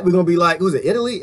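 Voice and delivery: ordinary delivery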